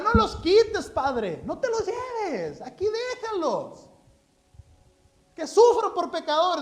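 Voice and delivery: high, whiny pleading voice; mock pleading Jesus. Preacher parodies Jesus begging the Father, high and whiny. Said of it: "No, no es cierto".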